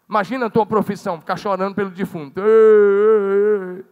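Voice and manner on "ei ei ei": loud hammed-up mourner's wail; mock wailing. Preacher mimics hired mourners wailing, hammed-up cry. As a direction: imitate paid mourners crying out loud.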